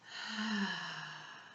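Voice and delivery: long, airy sigh; slow releasing exhale. Shown as a demonstration. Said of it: "A sigh out".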